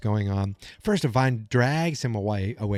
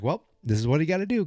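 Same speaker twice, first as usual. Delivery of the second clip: quick matter-of-fact Nancy; 7 semitones higher, faster than usual. Retold or imitated. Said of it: "Nancy's like".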